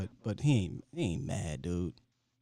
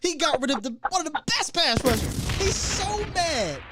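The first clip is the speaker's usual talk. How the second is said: worked-up rant; 11 semitones higher, 4 dB louder than usual. Real, not acted. Host riling up caller over the owner, heated and high. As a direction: voice shoots up high and loud, ranting.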